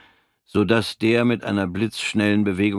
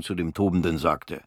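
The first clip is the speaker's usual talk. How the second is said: plain reading voice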